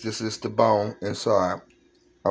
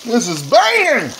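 excited exclamation; 8 dB louder, slower than usual. Real, not acted. Excited about fish.

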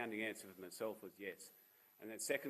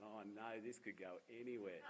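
softer, understated inner thought; privately bracing for the worst; retold quiet dread; 2 semitones lower, 10 dB quieter than usual.

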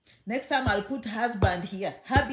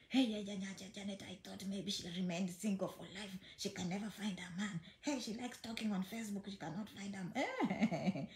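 playful gossip, murmured much softer; mock gossip; 3 semitones lower, 11 dB quieter than usual. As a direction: hush it like people mocking her single life. Voicing imagined gossipers again.

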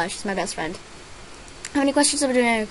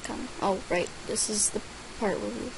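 usual speaking voice

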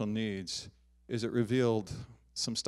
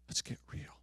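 whispered, softer repeat; 2 semitones higher, 11 dB quieter than usual. Acted. The Lord telling the congregation, whispered. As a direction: breathe it out as a hushed echo.

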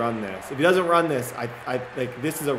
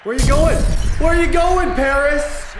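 high, loud mocking shout; taunting yell; 12 semitones higher, 7 dB louder than usual. Real, not acted. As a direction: jeer at the player for running off. Taunting the beaten player.

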